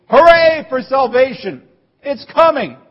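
high and loud hooray; hammed-up joyful cheer. Preacher voicing the crowd's joyous hooray. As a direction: cheer it like good news. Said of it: "it really means".

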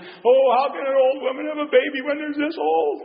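put-on a light scoffing old-woman voice; incredulous Sarah.

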